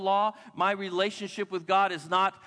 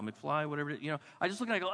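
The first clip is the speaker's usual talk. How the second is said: ordinary talk, nothing marked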